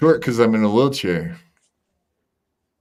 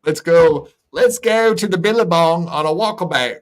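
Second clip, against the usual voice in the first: hammed-up Australian accent; 7 semitones higher than usual. Podcaster mocking Aussies for his co-hosts, hammed-up Australian accent. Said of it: "my horrible impersonations".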